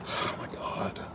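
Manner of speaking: breathy, voiceless oh my God after a sigh; exasperated whisper. Real, not acted. Driver exasperated at the glitching app, whispering. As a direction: whisper it, fully fed up. Sighing over the app again.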